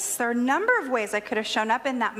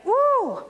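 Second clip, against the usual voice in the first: high, loud "woo" of rising steam; cartoon steam blast; imitated steam whistle; 10 semitones higher, 5 dB louder than usual. Imitating steam out her ears.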